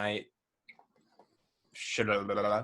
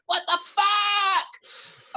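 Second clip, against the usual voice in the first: mock shock; 3 dB louder, much slower than usual. Viacom reacting to aired episode.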